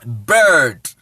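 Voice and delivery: "Bird" alone, open e, dropping low; demonstrated pronunciation. Shown as a demonstration. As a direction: model "Bird" as a lone word, like "bed". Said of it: "mo nim pronunciation no".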